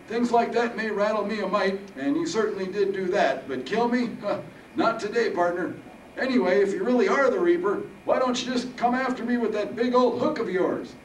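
put-on high, loud old-timer boasting; defiant old veteran Harold. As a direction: stand your ground as a feisty old soldier.

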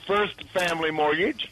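sponsor plug. Acted. Guest shouting the sponsor's name on cue. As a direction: sell it like a pitchman. Host prompts a sponsor read.

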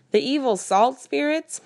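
slow, high scoffing question; mocking disbelief. A narrator's reading. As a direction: scoff at the idea slowly.

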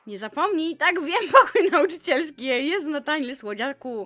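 loud, laughing, teasing repeat; amused loud mock reading; mocking laughing reread. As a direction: laugh through it as you read it again.

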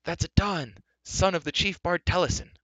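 high, loud delighted announcement; cry of pleasure. A narrator's reading. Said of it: "The bard gave a cry of pleasure".

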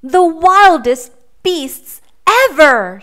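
spooky storyteller boast; dramatic boast. A narrator's reading. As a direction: stretch it out to scare them. Boy exaggerates to scare animals.

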